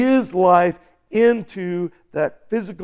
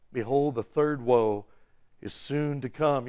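ordinary talk

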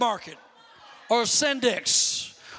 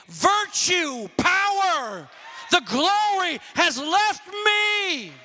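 proclaiming shout; 6 semitones higher, much slower than usual. Pretend. Jesus voiced in retelling.

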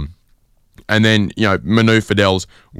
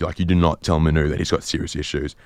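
ordinary talk

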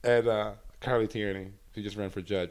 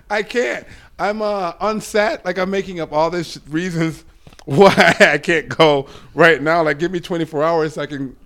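higher and louder, scrambling for excuses; panicked excuses; 7 semitones higher, 10 dB louder than usual. Retold or imitated. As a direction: rush out lame excuses half laughing. Retelling panic over drug test.

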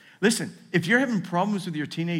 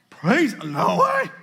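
incredulous objection; 7 semitones higher, 8 dB louder, slower than usual. Pretend. Congregation member objecting.

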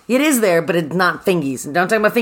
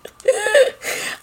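bright rising laugh, much higher; high burst of laughter. Real, not acted. Podcast host cracking up at clay story, laughing.

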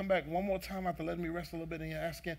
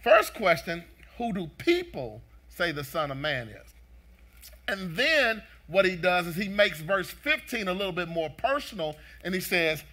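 forceful, emphatic sermon delivery; loud, driving preacher cadence; preaching; 5 semitones higher, 13 dB louder than usual. Real, not acted. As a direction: preach it with rising pitch and punched key words. Preaches to congregation.

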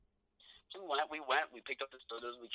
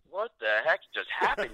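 stunned; 6 semitones higher, 5 dB louder than usual. Retold or imitated. Stunned reaction after the hug.

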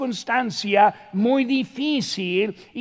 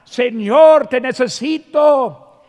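loud desperate cry, louder on Señor; 3 semitones higher, 7 dB louder than usual. Shown as a demonstration. Preacher showing the church how to cry out, loud plea. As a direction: shout it up to heaven, begging. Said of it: "como gritar".

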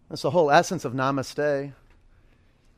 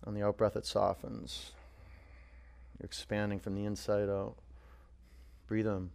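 guided meditation; 3 semitones lower, 4 dB quieter than usual. Acted. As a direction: ease them into stillness. Winding down the breath.

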